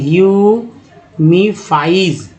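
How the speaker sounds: ह्यूमीफाइज stretched out slowly syllable by syllable; slow read-along. Shown as a demonstration. Teacher slowly reads ह्यूमीफाइज aloud for the class. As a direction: say ह्यूमीफाइज slowly so students can follow. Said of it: "वो हमारे साथ पढ़ें".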